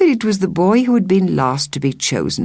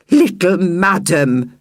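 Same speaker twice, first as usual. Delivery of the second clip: scolding woman; 3 semitones higher, slower than usual. Acted. Woman swipes at the girl.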